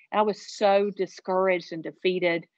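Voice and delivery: ordinary talk, nothing marked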